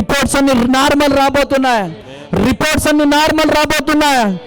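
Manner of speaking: rapid, driving, fervent declarations; full-force preaching. Real, not acted. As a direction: hammer each promise into the crowd.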